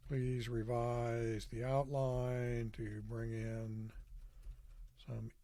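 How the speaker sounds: slow, stretched words with long gaps; drawn-out, halting dictation; dictating while typing. Real, not acted. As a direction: speak slowly as you type each word.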